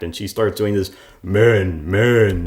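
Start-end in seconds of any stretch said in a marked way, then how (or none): 1.2-2.5 a hint of a mock macho grunt, louder; lightly voiced tough guy, mocking; mocking men. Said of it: "her interpretation of how men act".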